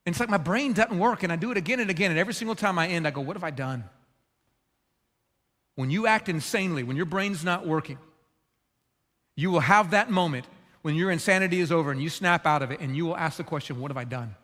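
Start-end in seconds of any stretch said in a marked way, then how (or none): 3.2-3.8 lower, hushed self-reproach; remorseful murmur. Retold or imitated. Father regrets losing his temper. 13.8-14.3 lower, hushed realization; stunned murmur. Retold or imitated. Listeners' moment of realization.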